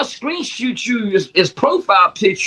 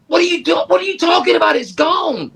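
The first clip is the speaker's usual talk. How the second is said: higher, fast alarmed cry of disbelief; retold panic; 8 semitones higher than usual. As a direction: replay the panic of hearing it's gone.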